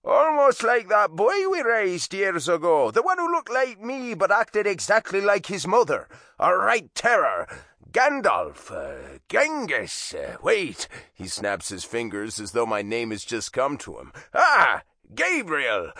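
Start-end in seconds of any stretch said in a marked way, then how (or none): 0.0-10.9 loud teasing Irish father; mock-forgetful Irish dad; Irish accent. A narrator's reading. 14.3-15.9 loud, high Irish eureka; Irish dad remembering a name; Irish accent. A narrator's reading. Dad pretends to recall.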